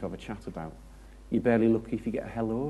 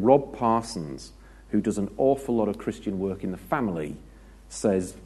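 ordinary talk, nothing marked

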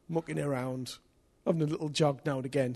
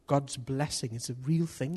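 usual speaking voice

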